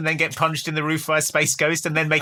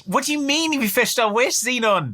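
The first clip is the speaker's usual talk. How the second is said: confused commander; 9 semitones higher than usual. Acted. Voicing confused Commander Planck.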